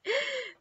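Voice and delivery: short high laugh; brief real giggle. Real, not acted. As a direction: laugh at your own slip. Laughs at own mistake.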